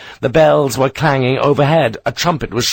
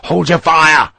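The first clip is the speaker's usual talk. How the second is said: louder, higher cry as he falls; shout; 5 semitones higher, 5 dB louder than usual. Acted. A rider falling under his horse, shouting. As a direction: yell it while going down. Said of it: "its rider shouted as he fell".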